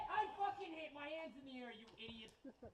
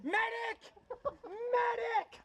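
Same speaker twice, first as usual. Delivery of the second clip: man down shout; 10 semitones higher, 6 dB louder, much slower than usual. Real, not acted. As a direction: yell medic high and loud. Shot, calling for a medic.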